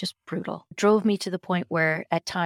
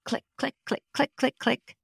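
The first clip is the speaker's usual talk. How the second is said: higher, light mock clicking sounds; imitated clicks; 7 semitones higher than usual. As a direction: tap out each click like a spine popping.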